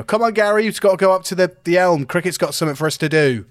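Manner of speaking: acted shout to a crewmate; shouting across the room. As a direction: call over to someone across a room. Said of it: "you hear me shout across the".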